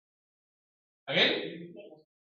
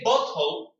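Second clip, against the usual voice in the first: BOTTLE in British, with a hard T; British pronunciation; 4 semitones higher, 5 dB louder than usual. Shown as a demonstration. Teacher warning about British bottle, British accent. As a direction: give BOTTLE a crisp British T. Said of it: "you're gonna say it in British".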